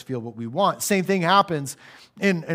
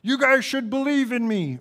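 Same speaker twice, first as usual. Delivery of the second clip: higher, self-promoting pitchman voice; lightly hammed-up bragging preacher; braggy sales pitch; 8 semitones higher than usual. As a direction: sell yourself like a huckster. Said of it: "sounds a bit braggy".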